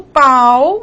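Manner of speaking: "pau" drawn out slowly, A gliding into U; falling diphthong. Shown as a demonstration. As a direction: stretch "pau" so the final U is heard. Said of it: "Som, a pronúncia, tá vendo?". ("pau" alongado, do A deslizando para o U)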